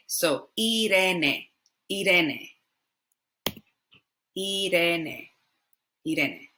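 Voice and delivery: Irene said ee-DEH-neh, tapped R; slow, Irene as ee-deh-neh; Spanish pronunciation. Shown as a demonstration. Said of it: "I is pronounced E".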